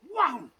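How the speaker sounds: a loud, high punched wham; sword strike. Acted. Storyteller acting out Macomie's sword blow, loud punchy wham. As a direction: hit it like the blade landing.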